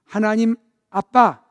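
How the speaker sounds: a loud call of Dad; calling out Dad to God. Shown as a demonstration. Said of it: "쑥스러워하지 마시고 해봅니다".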